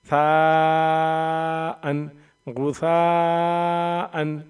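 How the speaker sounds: "साअन" and "गुसाअन" with सा held very long; madd. Shown as a demonstration. Teacher joining spelled letters with madd, सा hugely stretched in "गुसाअन". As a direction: sustain the सा like a chanted note in "गुसाअन". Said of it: "अलिफ जबर मद".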